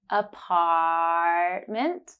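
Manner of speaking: slow "Apartment" with the ah held long. Shown as a demonstration. Teacher holding the ah in "Apartment" for learners. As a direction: stretch the ah in "Apartment" slowly. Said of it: "hold ah for three seconds for the first time".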